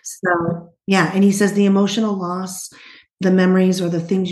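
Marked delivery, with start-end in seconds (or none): none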